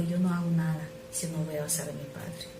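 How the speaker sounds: ordinary talk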